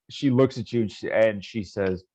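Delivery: usual speaking voice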